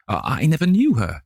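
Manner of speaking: high, frightened stammer. A narrator's reading. Dorian to James Vane, stammering high and afraid. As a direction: stumble over the first words in fear. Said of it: "he stammered".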